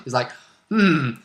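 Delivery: ordinary delivery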